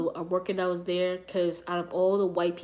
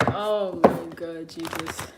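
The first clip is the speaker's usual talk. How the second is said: slow exclaim; 2 semitones lower, 5 dB louder, much slower than usual. Real, not acted. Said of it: "reacting to chat, laughing".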